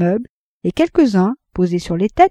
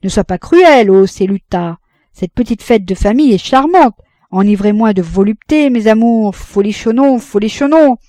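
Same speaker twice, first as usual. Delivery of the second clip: hammed-up stage bawl, much louder and higher; bawling hoarse actor; 3 semitones higher, 8 dB louder than usual. A narrator's reading. Le fêtard imite l'acteur Grasso, braillant d'une voix enrouée. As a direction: bellow like a hoarse old ham actor. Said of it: "braillait d'une voix enrouée pour imiter l'acteur Grasso".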